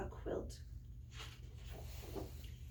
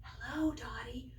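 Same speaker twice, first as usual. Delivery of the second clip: soft unvoiced whisper; timid little bird whispering; 6 dB quieter than usual. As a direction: breathe it out so no one hears. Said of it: "whispers the quiet quail".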